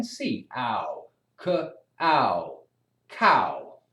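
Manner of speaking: "c-" and "ow" said apart, then blended into "cow"; sounding out a word. Acted. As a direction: sound it out piece by piece like a beginner reader. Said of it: "You're reading".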